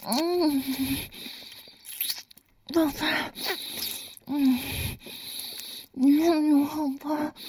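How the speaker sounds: 高亢的呻吟声，气息很重; 动情地低吟，音调上扬; aroused moaning. Acted. Intimate roleplay, breathy moans. (情动时高声呻吟)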